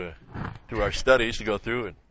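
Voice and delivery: ordinary talk, nothing marked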